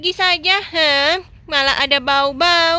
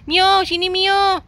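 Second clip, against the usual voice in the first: higher-pitched call to a sibling; calling over; 4 semitones higher, slower than usual. Acted. [name] calls Mio over to help, calling out.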